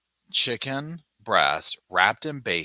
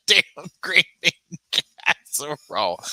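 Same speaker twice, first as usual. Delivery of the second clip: laughing; 8 semitones higher, 6 dB louder than usual. Real, not acted. Laughing through the phrase.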